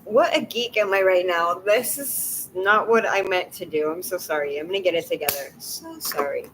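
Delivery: trying to steady the voice; tearful. Real, not acted. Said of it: "I don't know why I'm crying".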